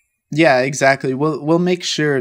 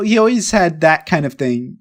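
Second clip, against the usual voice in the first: ordinary talk, nothing marked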